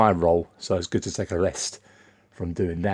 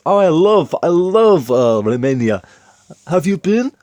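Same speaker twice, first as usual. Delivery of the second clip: higher-pitched, louder imitation of a Romanian accent; 10 semitones higher, 10 dB louder than usual. Romanian coworker gushing about his homeland, in a Romanian accent. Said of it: "I'll do it in his accent".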